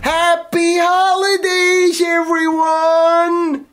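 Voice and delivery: slow, high, drawn-out American greeting; hammy Yank cheer; American accent. Acted. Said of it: "You're not American, son".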